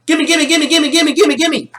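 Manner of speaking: put-on rapid-fire childish pleading; greedy little kid. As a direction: chant it like a kid demanding a toy. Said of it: "infamous little kid wants toy".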